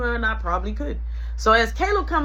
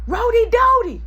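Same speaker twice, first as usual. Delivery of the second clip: high, loud call across the house; mom calling name; 13 semitones higher, 8 dB louder than usual. Retold or imitated. Mom calling her kid's name, high and loud. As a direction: call out the nickname. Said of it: "She calling my name".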